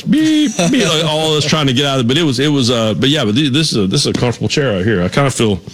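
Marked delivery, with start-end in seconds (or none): 0.0-0.8 high sustained beep; high-pitched mock vehicle beeping; imitated backup horn. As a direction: mimic a reversing truck's warning horn. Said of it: "you'd hear the backup horns".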